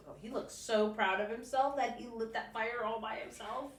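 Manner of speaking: ordinary delivery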